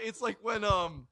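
usual speaking voice

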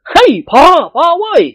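a high, loud shout cutting him off; shouting to stop him. Acted. An old nobleman cutting off a rambling servant, with a loud shout. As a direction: bellow to shut him up. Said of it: "เจ้าคุณศรีตะโกนลั่น".

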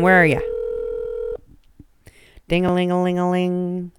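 ordinary delivery

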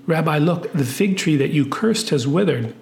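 ordinary delivery

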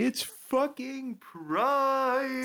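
high drawn-out cheer; excited cheer. Real, not acted. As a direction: stretch it out, celebrating. Cheering Pride Month.

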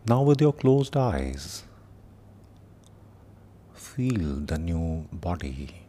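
slow meditation voice with long pauses; guided meditation. Acted. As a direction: guide them gently through the body, unhurried.

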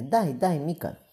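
put-on high, eager little child voice; a toddler grabbing for food, insisting; child's demand. Toddler begging the girl for meat, high and eager.

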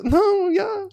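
squealing high protest. Real, not acted. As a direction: yelp it out high. Protesting the choice.

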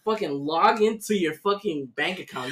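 ordinary delivery

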